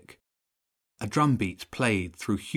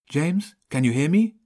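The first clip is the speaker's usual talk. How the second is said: higher, louder radio call; calling urgently; 6 semitones higher, 5 dB louder than usual. A narrator's reading. Child tries to reach friend by radio, calling. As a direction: call to someone over a radio, pitched up.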